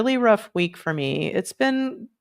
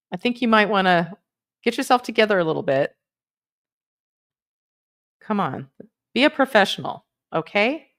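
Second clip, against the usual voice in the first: playfully scolding a colleague; lightly bossy telling-off; mock berating; 3 semitones higher, faster than usual. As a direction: chide with teasing pauses between phrases. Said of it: "that's enough of berating Coop".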